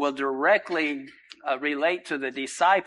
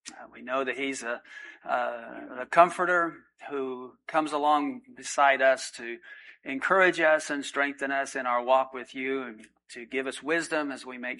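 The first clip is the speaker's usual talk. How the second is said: soft, measured prayer voice, a little quieter; pastor's prayer; 6 dB quieter than usual. Acted. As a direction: pray humbly over the congregation. Pastor leads congregation in prayer.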